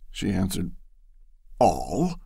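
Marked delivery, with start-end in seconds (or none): 1.6-2.2 stunned one-word echo; can't believe what he heard; incredulous. A narrator's reading. Bashford echoes Loretta in disbelief. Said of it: "a vast incredulity in his voice".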